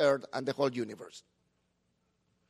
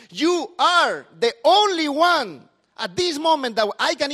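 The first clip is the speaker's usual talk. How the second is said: louder, higher pastor declaring worth; preaching; 4 semitones higher, 7 dB louder than usual. Acted. Pastor tells the congregation they are special, preaching loudly. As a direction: proclaim it to them with full force.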